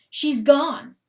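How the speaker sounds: ordinary delivery, nothing marked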